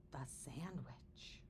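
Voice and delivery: hushed, slow reveal; dramatic whisper. A narrator's reading. Narrator reveals the culprit in a whisper. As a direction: whisper it like a secret.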